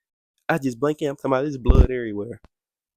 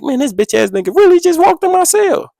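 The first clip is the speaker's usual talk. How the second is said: mock outrage; 3 dB louder than usual. Retold angry thought about cellmate.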